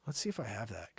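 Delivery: ordinary talk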